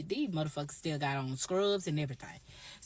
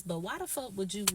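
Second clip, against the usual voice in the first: ordinary talk, nothing marked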